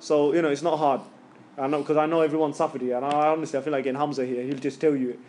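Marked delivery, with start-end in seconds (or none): none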